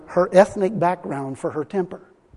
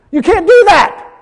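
laughing; 11 semitones higher, 8 dB louder than usual. Real, not acted. Laughing loud punchline.

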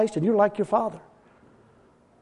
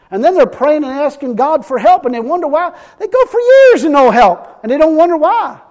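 climbs higher and louder into a shout; rising preacher shout; 7 semitones higher, 7 dB louder than usual. Real, not acted. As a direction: build it up to a full pulpit shout.

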